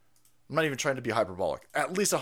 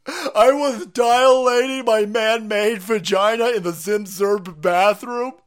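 shrill crying mock, much higher and louder, slow; mock whiny Spotify employee; 10 semitones higher, 10 dB louder, slower than usual. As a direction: wail like a hysterical offended staffer. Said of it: "confused goofballs at Spotify".

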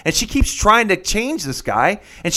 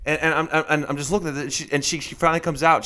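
ordinary delivery

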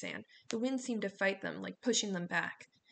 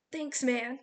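higher, louder yell over the wind; yelled thanks; 5 semitones higher, 5 dB louder than usual. A narrator's reading. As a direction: shout it over the storm. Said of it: "Leo yelled".

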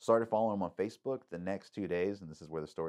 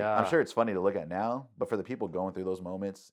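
normal talking voice

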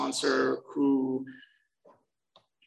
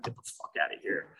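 scoffing whisper; 10 semitones lower, 8 dB quieter, faster than usual. Retold or imitated. Scoffing at twenty years sober.

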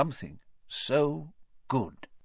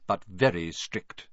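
plain reading voice

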